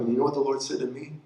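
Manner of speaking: ordinary talk, nothing marked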